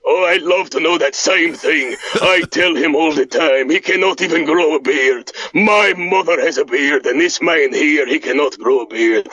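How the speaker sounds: put-on deep, proud Eastern European accent; Boris voice. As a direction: play the boastful Eastern European cousin. Boris teases about beard.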